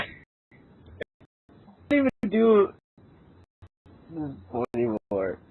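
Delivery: ordinary talk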